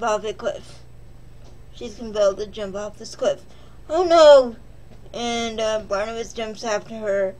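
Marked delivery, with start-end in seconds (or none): 3.9-4.6 loud, hammed-up gasp of alarm; mock dismay. Reviewer mocking the bride's cliff jump with a loud fake gasp.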